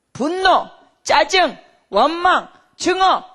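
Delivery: each word hit loud and high; forceful list. Acted. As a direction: spit out each sin. Listing sins forcefully. (한 단어씩 세게 외치듯)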